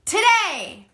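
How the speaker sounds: healthy projected call. Shown as a demonstration. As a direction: call it across the room, full chest voice. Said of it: "Try that with me".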